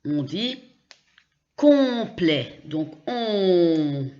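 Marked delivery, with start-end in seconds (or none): 1.6-2.6 complet high and loud, OM a nasal on. Shown as a demonstration. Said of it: "c'est comme O N". 3.1-4.1 on held long as one nasal vowel. Shown as a demonstration. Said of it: "c'est comme O N".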